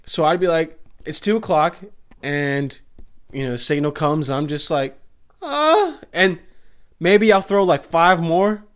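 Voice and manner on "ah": high falsetto fake sex moan; hammed-up breathy moan, pitched high; a silly high moan for the cellmate; mock moan. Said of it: "I'm making sex moans".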